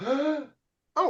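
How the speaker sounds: high-pitched mock delight; hammed-up high "oh"; mock surprise. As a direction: leap up high on a pretend thrill. Mock reaction to another pick.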